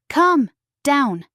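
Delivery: high, loud yell at the barrier; shouting. A narrator's reading. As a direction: hurl the words at the wall.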